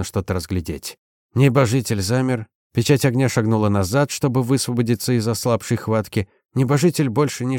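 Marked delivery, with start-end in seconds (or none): none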